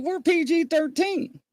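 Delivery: high, prim reminder; mock scolding. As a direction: play the shocked chaperone. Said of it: "I'm like".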